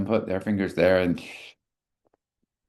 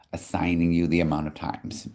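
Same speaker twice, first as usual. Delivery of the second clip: ordinary talk, nothing marked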